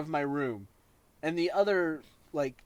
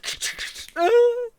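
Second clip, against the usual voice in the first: high cackle; 9 dB louder than usual. Acted. Imitating villain laugh.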